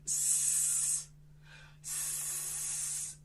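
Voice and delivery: unvoiced hiss; snake hiss. Shown as a demonstration. Magician giving kids an animal sound, snake hiss. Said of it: "What sound does this animal make?".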